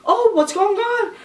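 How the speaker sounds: high, panicky question, imitated; mock panicked sister on the phone; freaking-out sister. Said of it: "freaking out on the phone".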